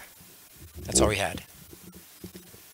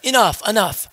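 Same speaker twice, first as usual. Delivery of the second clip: high-pitched mock exasperation; fed-up yogi; 10 semitones higher than usual. Yogi pestered by wife, kid and dogs, exasperated. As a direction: cry out at the end of your patience. Yogi has had enough.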